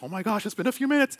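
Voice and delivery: high, sudden parent panic, played for fun; a hammed-up burst of parental alarm; mock panic. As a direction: suddenly realize the kid is gone. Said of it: "that panic moment".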